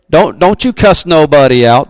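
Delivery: ordinary talk, nothing marked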